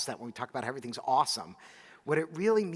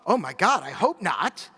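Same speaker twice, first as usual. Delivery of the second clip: alarmed exclamation; 3 semitones higher, 8 dB louder than usual. Retold or imitated. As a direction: blurt it out in alarm. Mother's horrified reply retold.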